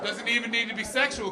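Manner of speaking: ordinary delivery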